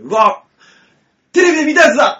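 high, loud mock amazement; acted excitement. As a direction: burst out like a starstruck tourist, playing it up. Acts out own thrilled reaction.

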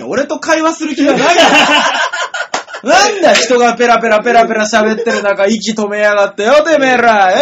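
loud mock yakuza scolding; rough delinquent tough talk.

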